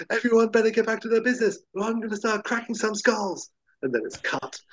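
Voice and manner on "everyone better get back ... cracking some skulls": higher, fast mock tough-guy warning; hammed-up macho threat; tough cop threat. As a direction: bark it out like a gung-ho cop. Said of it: "he says".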